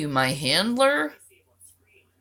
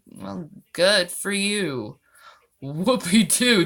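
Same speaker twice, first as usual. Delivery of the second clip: normal talking voice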